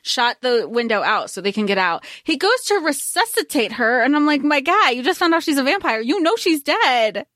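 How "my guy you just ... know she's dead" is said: higher, quick mock scolding; exasperated disbelief. Viewer to Michael trying to resuscitate a vampire, exasperated disbelief. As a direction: tell off a clueless character, incredulous.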